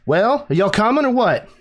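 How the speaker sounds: brash Southern pilot calling down to the party; Southern accent. Acted. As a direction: call down the gangplank in a big Southern twang. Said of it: "As soon as a Southern accent comes out".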